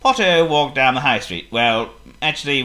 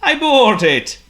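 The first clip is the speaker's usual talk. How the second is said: Potto voice; 12 semitones higher, 6 dB louder, slower than usual. Acted. Potto casual.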